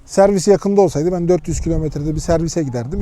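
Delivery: ordinary talk, nothing marked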